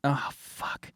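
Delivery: ordinary delivery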